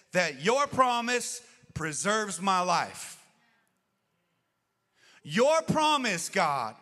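loud, high, slow impassioned preaching; preaching at full force. Acted. Preacher exhorting the congregation over music, loud and slow. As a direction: proclaim it slowly, ringing out.

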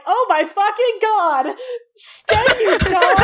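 high, slow, laughing disbelief; excited shout. Acted. Ren teasing the Arishok about his crush, laughing shout. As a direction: shriek it out between laughs. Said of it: "Ren's like".

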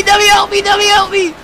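usual speaking voice

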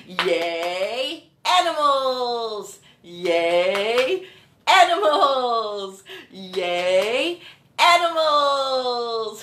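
slow, drawn-out "animals" after a low "Yay"; cheer yay animals. Shown as a demonstration. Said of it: "Let's say, "Yay, animals".

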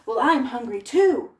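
loud troll reply; hungry troll. Acted. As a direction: answer like a greedy troll. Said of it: "said the troll".

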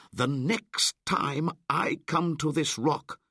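high, loud commands; commanding boy. Acted. Mowgli ordering Shere Khan and the wolves, commanding. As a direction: lay down the law to them.

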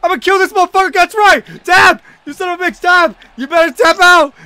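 loud, high gamer yelling; real worked-up high shouting; aggressive demanding shout. Real, not acted. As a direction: yell at the opponent to submit. Demanding opponent taps out.

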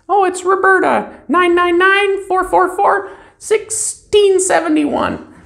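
a cheerful caller reading out her number; high caller voice. Shown as a demonstration. Caller Roberta giving her phone number, high and chirpy.

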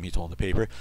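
ordinary talk

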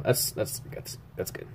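breathy whispered aside to himself, almost silent. Real, not acted. Artist whispering to himself while checking a drawing, hushed. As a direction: drop to a whisper, just for yourself. Muttering to self over drawing.

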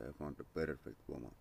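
normal talking voice

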